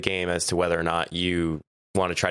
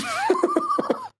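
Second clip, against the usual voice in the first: shrieking laugh. Real, not acted. Manager news, big reaction.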